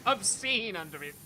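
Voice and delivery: slow, loud and animated; loud excited. Real, not acted. Excited rant to chat.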